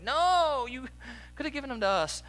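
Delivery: high, wailing mock protest; mock crying protest. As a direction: whine it like a heartbroken teen. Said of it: "I'm like, crying".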